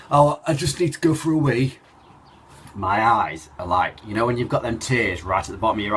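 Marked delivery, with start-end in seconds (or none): 0.1-1.8 higher mock dad voice, casual and oblivious; lightly voiced dad, raised and louder; imitating dad. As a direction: toss it off like a dad with no clue. Dad voiced in retold scene.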